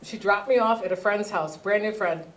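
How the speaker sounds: normal talking voice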